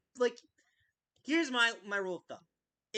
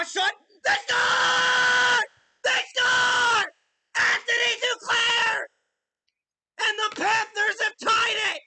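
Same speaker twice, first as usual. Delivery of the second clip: high, loud, drawn-out goal scream; goal call shout; 10 semitones higher, 9 dB louder, slower than usual. Real, not acted. Fan calling the tying goal, screaming. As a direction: explode like a play-by-play goal call.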